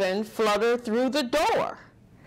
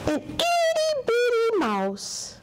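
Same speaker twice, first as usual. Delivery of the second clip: tiny mouse voice; 14 semitones higher than usual. Acted.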